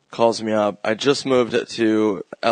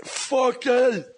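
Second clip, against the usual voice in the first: much higher, laughing mock shout; mock angry bully; 12 semitones higher, slower than usual. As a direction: yell like a squeaky bully, cracking up. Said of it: "what did I say?".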